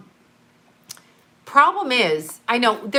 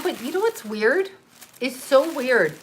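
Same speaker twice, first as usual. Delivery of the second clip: normal talking voice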